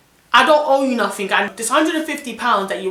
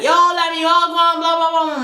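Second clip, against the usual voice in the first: high, loud put-on voice of a hyped friend; imitating the friend's loud phone greeting; caller's voice; 9 semitones higher, 6 dB louder than usual. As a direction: do the brash mate on the phone.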